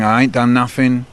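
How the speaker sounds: ordinary delivery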